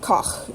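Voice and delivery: Koch slowly with a throaty ch at the end; German Koch. Shown as a demonstration. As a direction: drag out Koch with a scraping ch. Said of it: "The word for chef is Koch".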